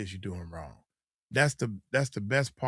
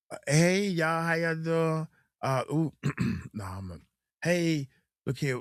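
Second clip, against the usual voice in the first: on-camera persona; 5 semitones higher than usual. Retold or imitated. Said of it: "you change into a certain person".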